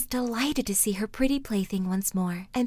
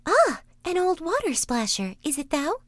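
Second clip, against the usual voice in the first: high, louder princess; 8 semitones higher, 4 dB louder than usual. Acted. Princess answering the frog: high voice. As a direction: lift the voice up high for the young princess. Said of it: "she said".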